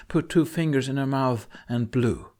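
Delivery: ordinary delivery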